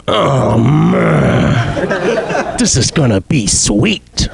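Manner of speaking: slow, gleeful creep; creepy menacing voice. Pretend. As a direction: savour it like a psycho. Menacing naked axe guy.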